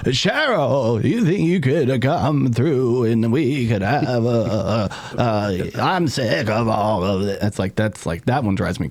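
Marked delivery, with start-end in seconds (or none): 0.1-7.4 halting, slow strained RFK voice; slow raspy RFK halting speech; RFK impression. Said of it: "Now you got your R F K going".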